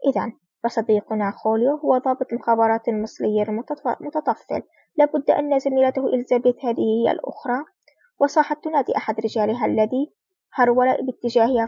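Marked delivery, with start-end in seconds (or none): none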